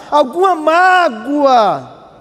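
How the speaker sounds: stretched out; slow. Shown as a demonstration. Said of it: "eu vou falar devagarinho".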